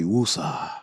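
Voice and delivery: low, soft "woosa" on an outbreath; self-soothing mantra, sinking in pitch; calming down. Real, not acted. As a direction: let the breath settle you. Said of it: "So let me chill".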